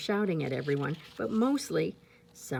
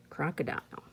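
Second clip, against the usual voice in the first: plain reading voice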